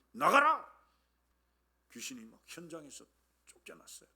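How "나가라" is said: forceful sharp command, raised voice; loud re-enacted exorcism command. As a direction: order the demon out with a sudden burst. Acts out casting out demon.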